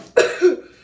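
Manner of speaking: a sharp burst of coughing; coughing fit. Real, not acted. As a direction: cough hard, throat burning. Coughs right after the hot sauce.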